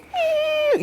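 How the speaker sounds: thin, very high imitated elk scream; distant bull squeal. Hunter imitating little bulls screaming in the distance. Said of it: "screaming in the distance".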